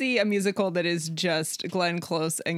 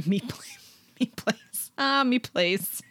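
amused echo of an Irish brogue; mimicked Irish accent; 4 semitones higher than usual. Co-host echoing the guest's phrase, mock Irish accent. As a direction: parrot the Irish accent, laughing.

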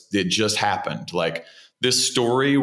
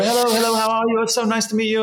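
Eric Idle greeting; 13 semitones higher, faster than usual. Retold or imitated. Eric Idle greeting the young actor, high and chipper. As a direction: bounce the hellos up high and bright.

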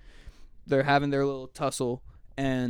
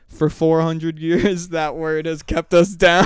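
slow, louder solemn pronouncement; solemn speech; 3 semitones higher, 10 dB louder, slower than usual. Retold or imitated. Actor in character lectures his costar, slow and grave. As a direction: deliver it like a grave movie monologue. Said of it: "and he goes".

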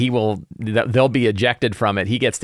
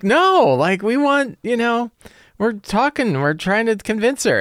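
much higher, hurried mock protest; flustered players; 12 semitones higher, slower than usual. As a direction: plead with the fiend in a high voice. Players explain to the fiend.

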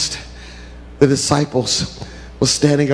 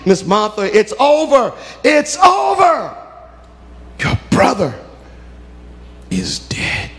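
breaking the news; 8 semitones higher than usual. Acted. Doctor announcing death.

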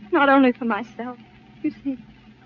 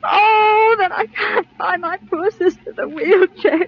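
sobbing out the plea; crying; 7 semitones higher, slower than usual. Acted. Job seeker sobs to the boss about her sick sister. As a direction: cry to melt his resistance. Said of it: "please, don't cry anymore".

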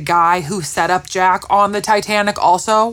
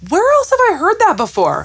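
puzzled; 9 semitones higher, 4 dB louder than usual. Retold or imitated.

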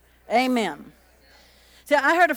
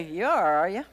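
pastor's warning tone; 5 semitones lower than usual. Retold or imitated. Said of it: "he'll look at you and say".

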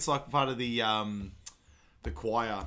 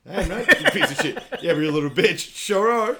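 loud mock insults; 7 semitones higher, 10 dB louder than usual. Acted insult exchange.

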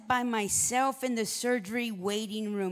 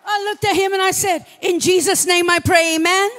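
preaching at full force; 7 semitones higher, 13 dB louder than usual. Acted. Pastor preaches the doctor's prayer to the congregation, full force. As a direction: lift the room in a fervent prayer.

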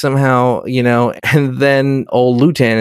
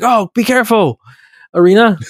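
mock concern; 9 semitones higher than usual. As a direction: fuss over her like a worried suitor. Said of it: "he's like".

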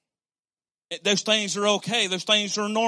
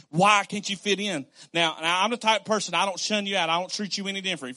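usual speaking voice

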